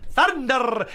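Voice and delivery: high-pitched "Thunder!" with a hard-rolled R; imitated rolled R. Host copying Luigi soundboard, "Thunder!" with hard-rolled R. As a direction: roll the R at the end of "Thunder!" in a high voice. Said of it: "he rolls his R's really hard".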